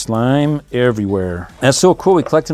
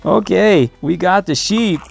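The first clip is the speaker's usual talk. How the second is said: excited; 8 semitones higher, slower than usual. Real, not acted. Celebrating finding the sheep.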